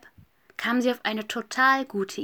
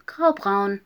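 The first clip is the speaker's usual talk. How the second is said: usual speaking voice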